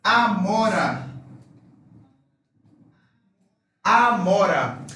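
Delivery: Amora with open A, drawn out slowly; slow open A word. Shown as a demonstration. Teacher repeating a student's word slowly. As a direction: stretch Amora, both A open. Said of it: "Ese es el sonido abierto".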